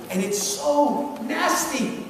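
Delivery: ordinary delivery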